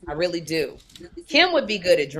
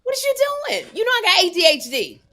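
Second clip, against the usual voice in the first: mock exasperated yell; 13 semitones higher, faster than usual. Host yells at Kim during a mock spat, high. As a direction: shriek it like you can't take it.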